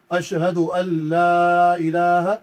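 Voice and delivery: لا drawn out for two to three alifs, slow chanted; long madd on la. Shown as a demonstration. Teacher shows the correct recitation, la stretched long. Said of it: "دو سے تین الف کے برابر کھینچ کے پڑھتے ہیں".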